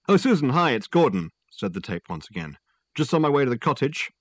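breezy caller on a car phone; voice on answering machine. Acted. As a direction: Gordon rambling into an answering machine. Dead man's voice on tape.

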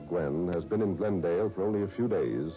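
ordinary talk, nothing marked

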